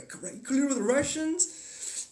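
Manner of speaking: mock incredulous viewer, slow and high; sarcastic. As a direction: sneer it mockingly. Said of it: "and you go".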